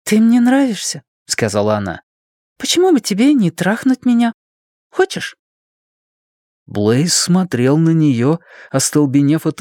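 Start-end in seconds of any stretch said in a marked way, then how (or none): 0.0-1.0 much higher-pitched female character; young woman's voice. A narrator's reading. Ann makes her advance on Blaise, high girlish voice. 2.6-5.4 much higher-pitched female character; young woman's voice. A narrator's reading. Ann makes her advance on Blaise, high girlish voice.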